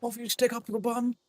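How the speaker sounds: hammed-up toff British accent, nose in the air; posh colonial British. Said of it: "My old colonial British".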